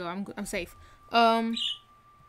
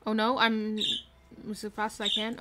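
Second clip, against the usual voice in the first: normal talking voice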